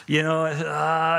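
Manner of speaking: long, drawn-out groaned "ah"; hammed-up suffering; mock weariness. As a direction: groan as if barely able to go on. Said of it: "you just about crawl into a room".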